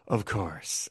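low, soft imitation of a sultry voice; imitated husky purr. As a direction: drop it low and quiet, mock-seductive. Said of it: "Margaret is in her husky-voiced, sultry thing".

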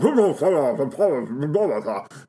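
mock wordless song mumbling. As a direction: babble nonsense like a novelty song. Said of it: "it's like the Beatles".

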